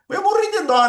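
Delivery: high, loud outburst of pity; emphatic, high-pitched exclamation; exclaiming with pity. Real, not acted. Exclaiming pity over the fired boy.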